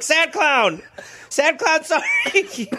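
high, laughing, sorry little clown voice; mock sad clown. Voicing the clown apologising, laughing through it. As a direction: whimper it like a sheepish clown. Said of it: "The clown's like".

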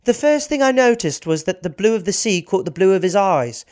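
quoting Gwen in a light English accent. Shown as a demonstration. Reading Gwen's memoir quote, light English accent. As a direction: read it as a prim English lady. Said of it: "I'll do an English accent".

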